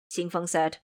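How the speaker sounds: ordinary delivery, nothing marked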